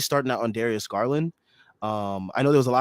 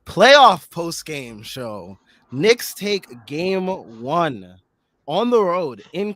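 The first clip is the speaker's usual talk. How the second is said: announcer voice; 4 semitones higher, 5 dB louder, much slower than usual. Acted. Announcing the show title.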